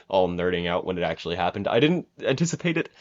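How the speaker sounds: ordinary delivery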